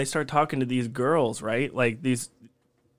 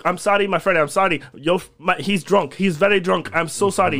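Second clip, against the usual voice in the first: pleading, higher-pitched imitation of an Arabic accent; broken-English Arabic accent; 4 semitones higher, 3 dB louder than usual. Drunk man's friend apologising to the cook, pleading Arabic accent. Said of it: "Only one dude spoke English, but barely".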